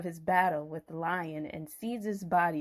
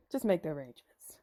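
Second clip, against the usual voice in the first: hushed urgent whisper; breathy conspiratorial whisper; 5 semitones lower, 6 dB quieter, faster than usual. Acted. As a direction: whisper it fast and secret. Whispered repeat of instruction.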